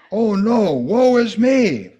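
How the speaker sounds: loud, high, slow wail; anguished cry. Acted. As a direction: cry out in despair. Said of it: "Isaiah cried".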